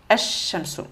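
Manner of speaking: "ash-shamsu" with the L silent and a long held SH; lam assimilé. Shown as a demonstration. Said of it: "supprimer le L". (« ash-shamsu », lam muet, chine allongé)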